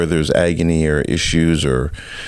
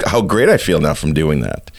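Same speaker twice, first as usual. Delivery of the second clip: ordinary delivery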